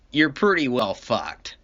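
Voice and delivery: usual speaking voice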